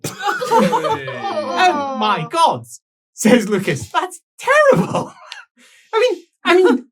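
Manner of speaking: high, loud, crying with laughter; can't stop laughing, near tears; helpless laughter. Real, not acted. Player cracking up at the story, high laughing squeals.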